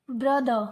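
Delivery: ब्रदर said brudhuh, no r at the end; ब्रदर with a silent r, British style; silent final r. Shown as a demonstration. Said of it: "इसको सिर्फ हम बोलेंगे ब्रदर".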